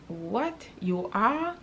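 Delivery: rising stunned what, you are; surprised. Retold or imitated. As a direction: retell the shock of the news with a lift. Retelling shock at friend's news.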